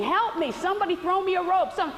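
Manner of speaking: very high, urgent mock scream. Struggling guy in the river screams for rescue, mock screaming. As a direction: scream for a rope, playing it up. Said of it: "he's screaming".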